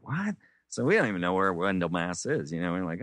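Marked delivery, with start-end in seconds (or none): 0.0-0.3 high, incredulous mock "What?"; shocked friends. Friends reacting to the narrator's plan, mock disbelief. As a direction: toss it up in disbelief.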